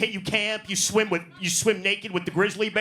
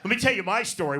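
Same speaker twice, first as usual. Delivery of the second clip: ordinary delivery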